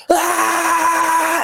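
very high, loud cry into the camera; primal scream. Retold or imitated. Winner screaming at the camera, raw primal yell. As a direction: let out a raw victory scream. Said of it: "I was like".

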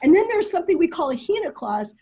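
ordinary delivery

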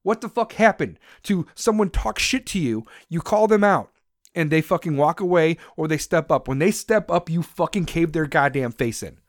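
normal talking voice

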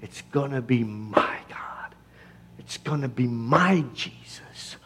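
breathy whisper, intense; whispered vow. Real, not acted. Preacher to congregation, intense whisper. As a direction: whisper it like a secret promise.

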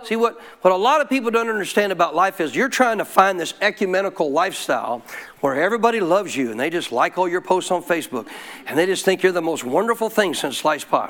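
ordinary talk, nothing marked

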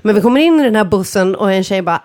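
usual speaking voice

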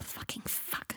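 hushed, whispered curse; through gritted teeth. A narrator's reading. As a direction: swear under the breath through gritted teeth. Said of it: "said Draco, gritting his teeth".

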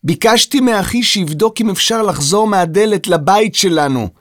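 put-on girl calling out her answer; shouting. A narrator's reading. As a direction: shout it to someone hard of hearing. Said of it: "צעקה ירדן".

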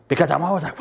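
normal talking voice

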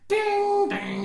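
high sung ding notes; imitating eerie music. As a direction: hum the creepy theme notes.

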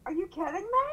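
can't believe what you're seeing; incredulous. Retold or imitated. Mother realizing the diamond was a boat, incredulous.

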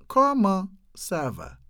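"Comment ça va" slowly, split in two chunks; slowed-down model. Shown as a demonstration. Teacher repeating the French slowly for learners. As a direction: leave a clear gap after "Comment".

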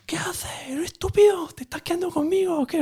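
mucho más agudo, imitando a un adolescente agresivo; hijo agresivo. Retold or imitated. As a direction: burla del chaval que se pone chulo. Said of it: "súper agresivo". (imitando al hijo adolescente, agudo y agresivo)

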